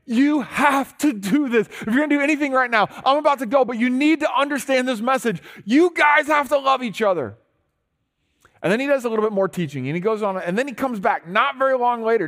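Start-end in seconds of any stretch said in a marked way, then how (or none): 0.1-7.4 high, loud, pressing plea; urgent plea. Retold or imitated. Jesus urging his friends before leaving, high and loud. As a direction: urge them like it's the last chance. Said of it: "he's looking at these guys going".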